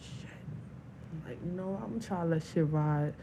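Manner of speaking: ordinary talk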